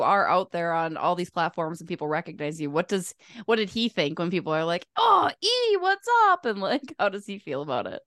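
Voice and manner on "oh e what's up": high-pitched, louder fan call-out; excited fan greeting. Retold or imitated. Imitating fans who recognize him in public, excited greeting. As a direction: imitate a thrilled fan hailing someone.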